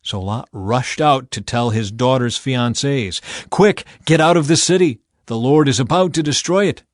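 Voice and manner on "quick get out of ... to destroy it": loud, raised, hurried alarm; frantic warning to the young men; urgent warning. A narrator's reading. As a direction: warn them to run. Lot warns fiancés urgently.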